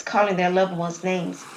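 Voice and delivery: ordinary delivery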